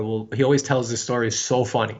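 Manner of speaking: usual speaking voice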